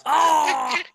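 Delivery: sudden high yelp of a jolted viewer; mock scream. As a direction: yelp as if thrown about in the seat. Mock scream of a whiplashed moviegoer.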